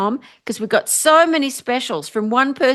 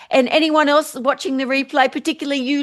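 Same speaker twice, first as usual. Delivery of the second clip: ordinary talk, nothing marked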